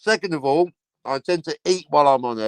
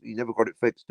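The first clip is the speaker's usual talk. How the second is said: ordinary delivery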